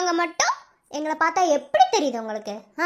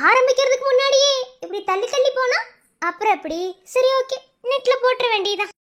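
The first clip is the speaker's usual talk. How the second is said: high, impatient scolding; exasperated, raised-pitch warning; irritated threat; 8 semitones higher than usual. Acted. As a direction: threaten her with rising irritation. Threatens her as she resists.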